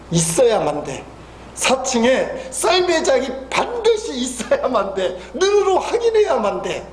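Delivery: normal talking voice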